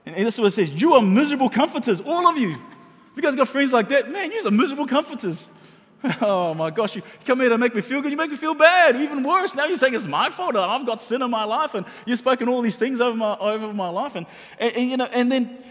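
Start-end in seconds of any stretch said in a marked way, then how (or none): 0.7-2.6 fiery rebuke. Acted. Reading Job's rebuke with fire. 4.1-14.1 exasperated complaint. Acted. Voicing Job's complaint, laughing.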